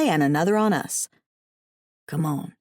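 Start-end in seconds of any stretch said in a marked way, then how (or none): 2.1-2.5 whisper. A narrator's reading. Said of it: "he whispered".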